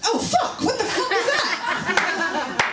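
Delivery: high, slow squeal of disgust; disgusted shock. Retold or imitated. Smelling the kitchen sponge, recoiling in high disgusted shock. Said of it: "You're like".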